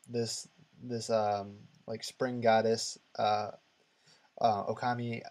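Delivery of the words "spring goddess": normal talking voice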